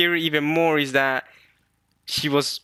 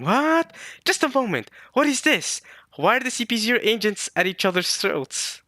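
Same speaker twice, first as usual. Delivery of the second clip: high, startled voicing of Brooke's alarm; shocked outburst; 9 semitones higher than usual. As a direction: act out Brooke's panicked confusion. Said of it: "I'm guessing Brooke is going".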